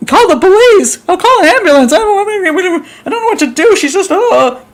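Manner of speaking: high-pitched acted panic; frantic, high, wailing mock panic; freaking out. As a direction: play hysterically reporting a shooting. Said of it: "you're freaking out".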